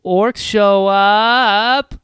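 drawn-out, loud, high announcement; dramatic reveal. Pretend. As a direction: slowly boom out the ominous arrival.

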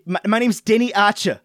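imitated South African movie accent. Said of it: "like, South African thing".

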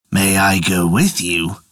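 low, slow dog voice. Acted. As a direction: ask low like a big dog. Dog asks to come.

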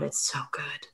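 breathy hushed praise; whispered. Real, not acted. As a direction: whisper it with awe. Quiet heartfelt aside.

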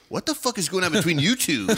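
high, loud outraged demand; imitated angry roommate. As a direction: act out a furious roommate confronting them. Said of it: "like he was so mad".

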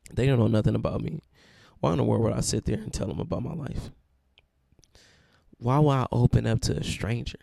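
mock protest. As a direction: vent like someone refusing therapy. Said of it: "I made the same statements".

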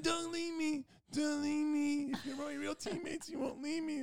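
very high pleading whine; pleading teammate. Retold or imitated. Teammate begging friends to stay, a high whining plea. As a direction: beg them not to go, whining. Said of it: "he was pleading".

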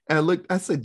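usual speaking voice

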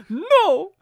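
high, loud mock cry of no; mock shout. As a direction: act out the mum's shocked no. Retold mum's outburst.

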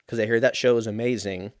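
ordinary talk

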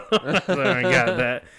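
loud, slow, laughed-out words; laughing. Real, not acted. Admitting he lacks the work ID, laughing loudly. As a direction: talk right through the laugh. Cracks up while retelling.

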